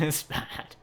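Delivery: breathless whisper. Acted. Riddle repeating his warning, whispered. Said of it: "repeats Riddle's line".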